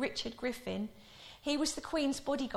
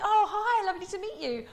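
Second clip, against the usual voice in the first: 1 American tourist; 8 semitones higher, 5 dB louder than usual. Retold or imitated. American tourists greeting the Queen.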